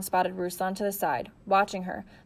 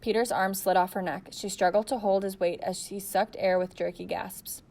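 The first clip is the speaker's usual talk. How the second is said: usual speaking voice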